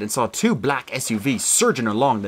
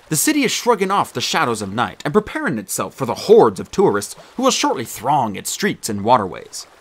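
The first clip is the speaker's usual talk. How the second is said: usual speaking voice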